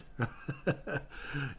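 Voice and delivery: quiet breathy laugh; a short soft chuckle. Real, not acted. Laughing at own joke.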